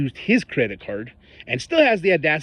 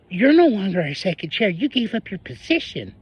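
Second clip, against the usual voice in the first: quoting her; 6 semitones higher than usual. Retold or imitated. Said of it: "She says".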